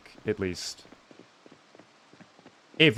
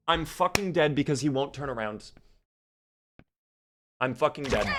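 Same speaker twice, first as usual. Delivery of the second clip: ordinary talk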